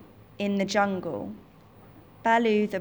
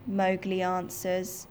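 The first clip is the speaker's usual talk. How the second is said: ordinary delivery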